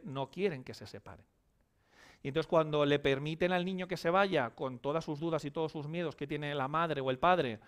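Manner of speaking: ordinary delivery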